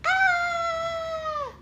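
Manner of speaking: long high playful squeal; scared piggy squeal. Pretend. Mother repeats the piggy's cry for her toddler, mock-scared squeal. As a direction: hold a pretend shriek of fright. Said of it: "When the piggy's scared, he goes".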